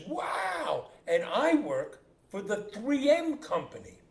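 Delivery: high, loud, marvelling outburst; hammed-up astonishment at a coincidence; acted amazement. Second father hears he has triplets, amazed.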